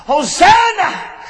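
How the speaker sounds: long, high, slow shouted Hosanna; preacher crying out Hosanna as a prayer for help. Acted. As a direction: cry it out high and drawn out. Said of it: "Hosanna means".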